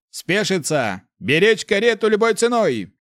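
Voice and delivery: high, loud battlefield order; commander's high-pitched bellow; roared command. A narrator's reading. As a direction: shout orders over the troop's heads. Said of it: "рявкнул Ардаль".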